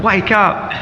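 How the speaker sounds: high, urgent wake-up call; eager young monk. Acted. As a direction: rouse a sleeper in a hurry. Young brahmachari shaking him awake.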